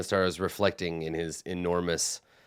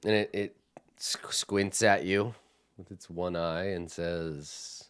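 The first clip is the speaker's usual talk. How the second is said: ordinary talk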